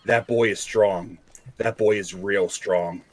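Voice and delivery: ordinary delivery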